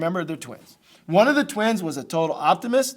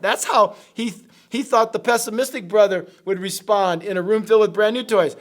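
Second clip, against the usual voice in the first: normal talking voice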